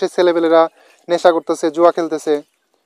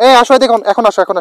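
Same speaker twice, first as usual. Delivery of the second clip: higher, louder call to come over; yelling across the room; 4 semitones higher, 3 dB louder than usual. Real, not acted. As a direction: call them over from a distance. Calling the boys over.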